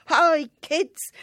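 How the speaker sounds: put-on high, loud cheery call to the kids; panto greeting. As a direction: hail the whole audience. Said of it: "He would run onto the stage with".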